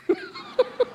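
sudden high burst of laughter. Real, not acted. Preacher laughs at his own joke, high burst. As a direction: crack up at the punchline building.

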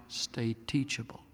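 soft unvoiced whisper. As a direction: hush the refrain intimately. Repeated refrain, hushed.

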